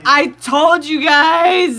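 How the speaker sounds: loud, slow I-told-you-so; triumphant. Real, not acted. Streamer gloating to chat, slow triumphant shout. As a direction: drag it out in triumph.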